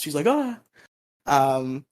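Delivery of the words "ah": high little gasp; a quick high startled gasp; mock gasp. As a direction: gasp in shock, lightly. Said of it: "Kimberly gasps".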